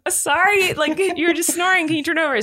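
high-pitched, placating retold apology; mock apologetic plea. Placating the angry sleeper.

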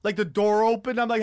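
usual speaking voice

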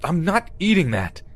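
high-pitched, defiant protest; indignant refusal. A narrator's reading. Captive turning away from the fork, indignant. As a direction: refuse outright, pitch jumping up.

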